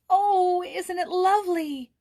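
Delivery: high, loud, thrilled outburst; delighted exclamation. A narrator's reading. Joyce thrilled at the news, delighted exclamation. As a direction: gasp it out with pure delight. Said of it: "exclaimed Joyce".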